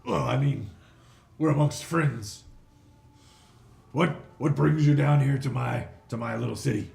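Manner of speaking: loud, bossy crime-lord hobgoblin; greedy hobgoblin boss. Acted. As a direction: bark orders like a greedy fat boss. Hobgoblin greets the party.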